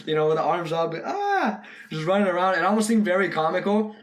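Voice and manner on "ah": a quick high yelp; imitated short yelp. Vlogger imitating a character running with arms up, brief yelp. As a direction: toss off a little scared cry. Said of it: "the way he was screaming".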